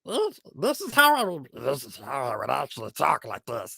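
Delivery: grizzled goblin voice. Shown as a demonstration. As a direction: put a little grizzle on a small goblin. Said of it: "lower it down just a little bit and put a little grizzle on it".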